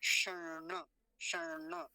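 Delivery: शर्ण as shaṇ-ṇa, lingering on retroflex n; light half n, then full na in शर्ण; retroflex model. Shown as a demonstration. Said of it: "णकार आधा है तो हल्का सा ही".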